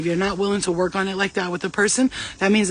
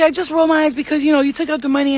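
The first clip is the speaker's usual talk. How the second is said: high, louder put-on excuse; mock excuse; 7 semitones higher, 5 dB louder, faster than usual. Caught woman explaining her eye roll, high mocking imitation. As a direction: mimic her defending herself in a raised pitch.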